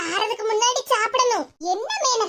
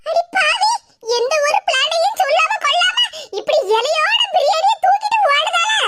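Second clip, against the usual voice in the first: loud, higher outraged shout; shocked yell; 5 semitones higher, 4 dB louder than usual. Acted. Friend shouts after the sister who ran off. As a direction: yell after her in disbelief.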